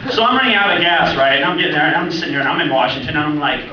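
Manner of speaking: ordinary delivery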